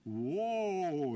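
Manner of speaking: high, loud cry to halt a horse. A narrator's reading. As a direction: cry out loud, trying to stop a runaway horse. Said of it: "he cried".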